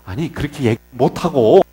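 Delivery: ordinary talk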